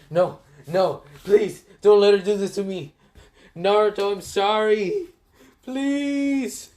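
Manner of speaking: high, loud desperate pleading; begging Kakashi. Acted. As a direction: beg for mercy, voice cracking high. Kakashi begs not to burn.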